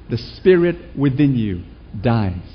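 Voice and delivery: ordinary delivery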